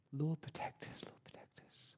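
breathy whispered plea, fading to nothing; whispered prayer. Retold or imitated. Mum whispering a prayer over her son at bedtime, whisper. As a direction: pray it under your breath, barely audible.

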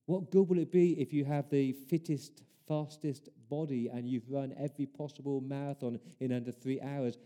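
usual speaking voice

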